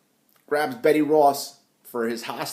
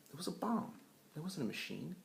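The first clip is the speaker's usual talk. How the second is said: 1 muttered aside; 3 semitones higher, 18 dB quieter than usual. Real, not acted. Sarcastic aside on the plot.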